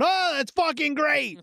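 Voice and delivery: mock public cursing. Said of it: "out in public going".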